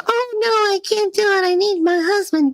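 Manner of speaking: very high, whimpering damsel act; mock a helplessly dependent wife; hammed-up high, feeble plea; mock helpless softie. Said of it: "She is the softest friggin'".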